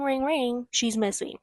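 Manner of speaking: ordinary talk, nothing marked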